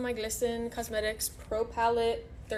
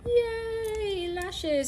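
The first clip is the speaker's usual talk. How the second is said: happy cheer; 11 semitones higher, 4 dB louder than usual. Real, not acted. Cheers as the lashes go on.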